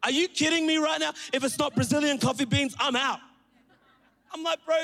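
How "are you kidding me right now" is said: ordinary delivery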